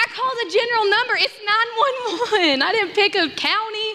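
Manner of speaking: high, fast indignant; exasperated high protest. Retold or imitated. Exasperated at dispatcher.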